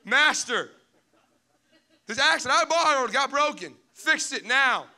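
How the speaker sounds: high, loud laughing panic; mock panic. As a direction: whine at the master, half joking. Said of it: "this would be me".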